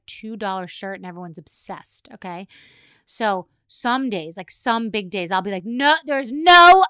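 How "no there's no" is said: loud, high, drawn-out protest; emphatic disbelief. Retold or imitated. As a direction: gush in over-the-top disbelief. Acted gushing over daughter's outfit.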